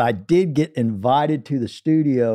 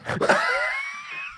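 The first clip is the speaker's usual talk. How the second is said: shrieking laugh; 7 dB quieter than usual. Real, not acted. Reacting to wrong song punchline.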